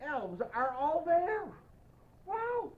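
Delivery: usual speaking voice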